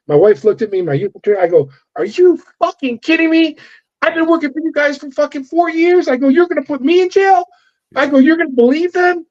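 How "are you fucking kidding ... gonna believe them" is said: high-pitched incredulous protest, replayed for the listener; reliving the moment you learned they would charge you; mock indignant yell, pitched high; retold outraged outburst.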